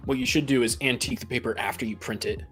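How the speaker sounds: ordinary talk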